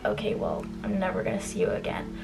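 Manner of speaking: breathy whispered mock line. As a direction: toss it off in a whisper. Imitated dismissive classmate.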